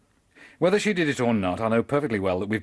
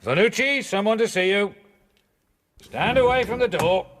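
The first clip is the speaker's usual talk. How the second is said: called command; 7 semitones higher than usual. Acted. Calling through a cell door.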